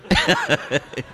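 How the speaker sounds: high-pitched run of laughs; real amused laugh after a joke; laughter. Real, not acted. Man joking about his forgotten pin, laughing high.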